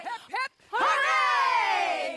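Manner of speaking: rising cheer that ends in a loud shout; rally the marchers with a cheer. Acted.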